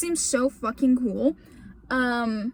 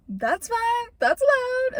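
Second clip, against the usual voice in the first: high-pitched, giggly mock acceptance; giggly retold consent; 9 semitones higher, slower than usual. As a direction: squeak it out through a giggle, retelling. Retold giddy consent to a hug.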